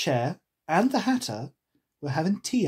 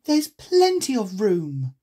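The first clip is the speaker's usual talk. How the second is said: put-on slow, raised, indignant girl voice; higher, affronted storybook heroine; 6 semitones higher, 5 dB louder, slower than usual. A narrator's reading. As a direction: protest loudly, stretching it out. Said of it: "said Alice indignantly".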